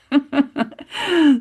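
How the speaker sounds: spontaneous high chuckle; amused laugh. Real, not acted. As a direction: laugh lightly at your own joke. Laughs at own joke.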